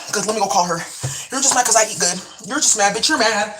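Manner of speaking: ordinary talk, nothing marked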